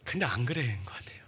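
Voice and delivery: sincere whispered doubt. Real, not acted. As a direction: drop to a whisper, confiding. Quiet aside after a joke.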